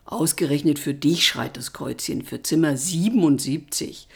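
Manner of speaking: low, breathy sleepy grumble; mocking grumble. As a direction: tease him in a drowsy voice. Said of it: "brummte er". (verschlafen spöttisch brummend)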